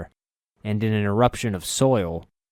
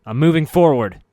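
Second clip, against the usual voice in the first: battle shout; 7 semitones higher, 10 dB louder than usual. Acted.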